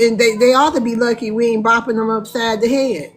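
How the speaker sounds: ordinary talk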